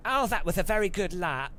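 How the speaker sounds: high, camp race engineer praise; camp pit voice. Pretend. Joking about the car's pit voice, gushing camp. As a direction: gush like an over-the-top camp engineer. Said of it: "the voice in the car is gonna be like".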